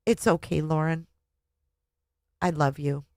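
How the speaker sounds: soft and quiet, falling; quietly comforting her own reflection; gentle self-comfort. Acted. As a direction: soothe yourself softly.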